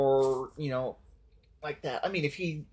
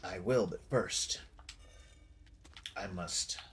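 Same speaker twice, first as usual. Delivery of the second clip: low, quiet, measured, a hint of British; focused character voice; 5 semitones lower, 8 dB quieter than usual. Gamer voicing his knight, low quiet focused British-tinged voice. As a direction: play a grim focused knight, lightly British-esque. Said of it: "I will attempt to throw some, some of a British-esque accent in there".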